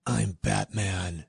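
deep, slow, gravelly growl; gravelly Batman voice. Shown as a demonstration. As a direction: drop low and growl it slowly. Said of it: "I tried to get a little gravelly there".

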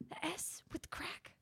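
whispering. Acted. Reading a rant, whispered aside.

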